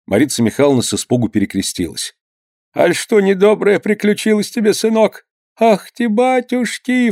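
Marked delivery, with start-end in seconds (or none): 2.8-7.1 высокий старушечий голос, с испугом; ахни по-старушечьи, испуганно; испуганная старуха. A narrator's reading. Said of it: "всплеснула она руками". (мать пугается слов сына, высоко)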